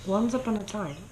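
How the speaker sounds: ordinary delivery, nothing marked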